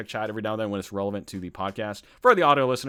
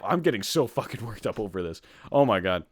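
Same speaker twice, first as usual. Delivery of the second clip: ordinary talk, nothing marked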